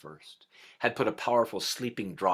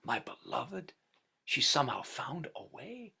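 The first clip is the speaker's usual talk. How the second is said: hushed awe for his beloved; tender; 6 semitones higher than usual. A narrator's reading. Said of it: "and he said".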